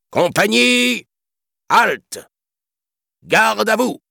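high, loud parade-ground command; shouted military order. A narrator's reading. Elephant chief halting his troop, shouting. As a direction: bellow each order, drawing the words out. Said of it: "cria le chef des éléphants".

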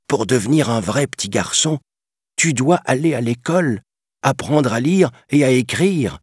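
Geppetto, voix plus aiguë et ferme; un papa qui insiste gentiment; vieil homme affirmatif. A narrator's reading. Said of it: "affirma Geppetto". (vieux père convaincu)

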